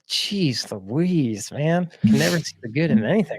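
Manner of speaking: acted-out exasperation, quick swings in pitch; exasperated dad. Dad scolding his pessimistic boy, mock exasperation. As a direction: play a fed-up dad, half laughing.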